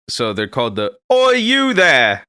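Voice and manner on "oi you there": much higher, louder, slow hammed-up Cockney "Oi, you there!"; awful British accent. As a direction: overdo the bad British accent. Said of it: "Awful accent".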